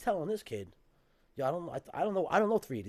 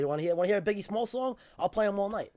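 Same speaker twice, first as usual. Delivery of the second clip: heated, louder and higher; angry; 6 semitones higher, 7 dB louder than usual. Real, not acted. Guest demanding they hear his story, angry. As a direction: push back hard to win the floor. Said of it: "don't get angry".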